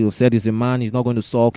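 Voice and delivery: usual speaking voice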